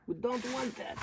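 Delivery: high, sing-song repeat; mock high voice. Repeating to viewers that settling is bad, high voice. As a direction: lift it way up, playful.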